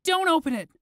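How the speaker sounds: put-on high, loud panicked boy's yell; scared yell. A narrator's reading. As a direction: shout it in terror. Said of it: "Flix yelled, trembling".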